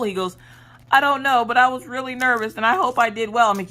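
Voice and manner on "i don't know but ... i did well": put-on higher-pitched anxious child voice; nervous kid. Son to mom after his eye exam, nervous kid. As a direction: imitate a worried kid explaining himself. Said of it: "I was so nervous".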